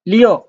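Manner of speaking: Liu pitched high, then dropping sharply; short falling Mandarin tone on Liu; fourth tone. Shown as a demonstration. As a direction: drop Liu steeply from a high peak. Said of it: "the fourth tone".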